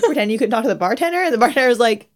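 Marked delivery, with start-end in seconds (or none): none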